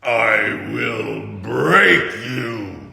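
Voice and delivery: very slow, loud villain growl; hulking villain threatening the hero, slow and loud; slow menacing threat. Acted. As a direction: stretch every word like a promise. Bane threat.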